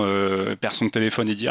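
normal talking voice

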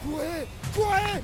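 high, loud cry to run; shouting. Acted. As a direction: yell the warning in panic. Monster attacks, warning the others.

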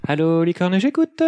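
singsong operator greeting climbing high. Acted. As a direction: sing out the hotline greeting, ending high.

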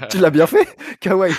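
laughing while praising. Real, not acted. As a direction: laugh through the words. Laughing at the impression.

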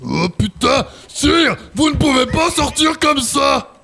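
high, slow, shouted alarm; panicked shout. Acted. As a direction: yell to stop the king undressing. Valet panics at king undressing. (cri paniqué, aigu et lent)

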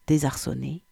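ordinary delivery, nothing marked

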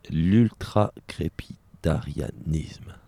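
very slow, deliberate word; slow careful reading. Shown as a demonstration. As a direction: spell out the long word slowly. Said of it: "lit un mot compliqué". (lentement, en détachant le mot)

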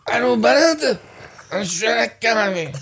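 high, hammed-up phone greeting; mock caller. Host clowning during the spot recording, hammed-up silly voice. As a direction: overplay a silly, high-pitched hello.

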